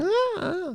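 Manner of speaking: put-on high childish "ah, ah" babble; kid noise. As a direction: make the noise a little kid makes. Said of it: "that's what kids do, that noise".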